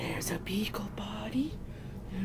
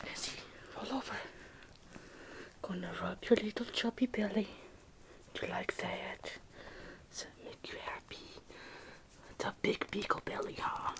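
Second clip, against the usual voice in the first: normal talking voice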